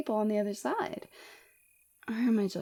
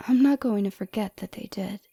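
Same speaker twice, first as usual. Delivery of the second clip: usual speaking voice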